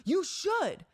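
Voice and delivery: high, loud, slow mock yell; hammed-up irate driver yelling; retold angry yelling. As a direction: imitate the woman shouting back from her car. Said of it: "she kept yelling at me".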